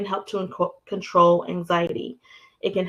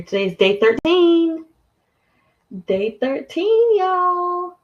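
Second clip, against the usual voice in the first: high, loud cheer with drawn-out endings; excited announcement; 10 semitones higher, 7 dB louder, slower than usual. Real, not acted. Host announcing day thirteen to viewers, excited cheer. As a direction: sing out the news, high and loud.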